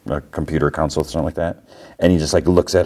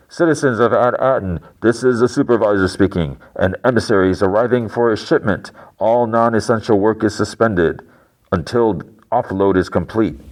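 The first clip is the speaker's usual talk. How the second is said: higher, formal announcer delivery; PA announcement; 4 semitones higher than usual. Retold or imitated. Supervisor to citizens over PA, formal announcement. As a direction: imitate an official addressing the public.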